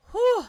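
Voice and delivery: loud high exhale to steady yourself; shaky exhale. Real, not acted. Player steadying after crying, loud exhale. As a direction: blow out the tears. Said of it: "I'm fine".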